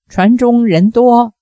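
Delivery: ordinary delivery, nothing marked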